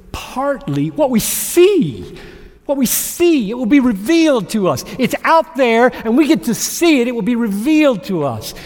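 full-force preaching. Real, not acted. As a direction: proclaim it like a rallying cry.

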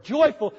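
Joyful said alone, loud and high, then falling; stress on joyful. Shown as a demonstration. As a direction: lean hard on Joyful to savour it. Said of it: "That was a good word".

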